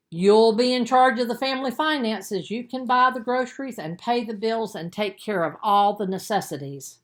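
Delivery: a mother challenging her son; firm mother. Acted. Mother makes Ben a deal.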